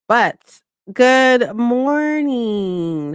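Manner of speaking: slow, drawn-out good morning; stretched greeting. Real, not acted. Streamer greeting her chat, slowly drawn out. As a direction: stretch every word of the greeting out.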